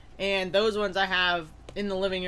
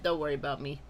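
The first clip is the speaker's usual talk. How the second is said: ordinary talk, nothing marked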